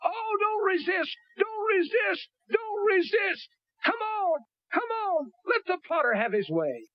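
high, slow, crying plea; sing-song plea of finished pots; pleading heavenly chorus. Pretend. Preacher voices heaven's chorus, high crying plea. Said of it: "the chorus ... on the shelves crying".

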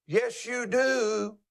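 high, slow crowing words; rooster voice. Retold or imitated. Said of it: "The rooster said".

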